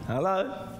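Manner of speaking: ordinary talk, nothing marked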